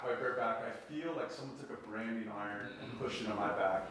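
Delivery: ordinary talk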